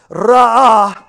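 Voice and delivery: "ra'ah" with a long, loud trilled R; rolled R. Shown as a demonstration. Preacher demonstrates the Hebrew word "ra'ah", R strongly rolled. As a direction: roll the R hard in "ra'ah", drawing out the vowels. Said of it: "the word in the Hebrew for provided, it's pronounced".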